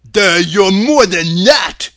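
goofy, loud hurt protest; grieved Scarecrow. A narrator's reading. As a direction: complain as a wounded dimwit. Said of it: "in a grieved tone".

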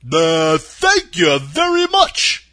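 loud, slow, grateful scarecrow; booming thanks from a straw man. Acted.

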